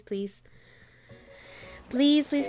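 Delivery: ordinary talk, nothing marked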